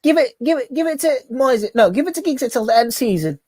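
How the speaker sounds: lightly voiced desperate fan demanding a new manager; imitating Andy Tate. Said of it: "That was classic".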